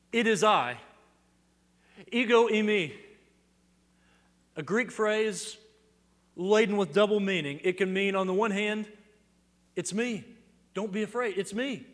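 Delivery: loud, high proclamation; preaching at full force. Acted. Preacher voicing Jesus to the congregation, loud fervent proclamation. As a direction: ring out the words like good news.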